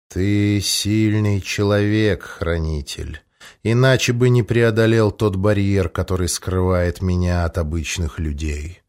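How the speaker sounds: low, slow, velvety dragon voice; a calm, menacing dragon speaking slowly; velvet dragon voice. A narrator's reading. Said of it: "бархатный голос". (низкий бархатный голос дракона)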